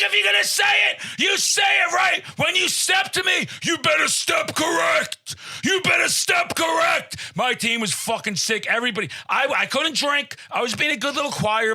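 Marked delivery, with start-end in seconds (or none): 0.0-7.2 high-pitched, belligerent shouting; shouted challenge. Retold or imitated. Contestant confronts the trivia host, shouting. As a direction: lay down the law at a high yell.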